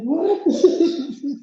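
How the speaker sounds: high, amused "What?"; retold delighted disbelief; laughing surprise. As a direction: caught off guard and laughing. Hears thirty miles, laughs.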